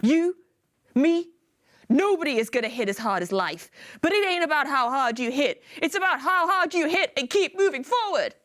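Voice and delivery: high, impassioned motivational movie speech; acted film speech. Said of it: "some sort of level of acting".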